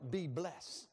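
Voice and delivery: ordinary talk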